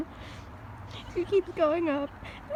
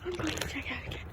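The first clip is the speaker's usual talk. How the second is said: whispering; 14 dB quieter, faster than usual. Pretend. Customer sneaking to checkout.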